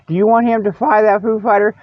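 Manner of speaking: normal talking voice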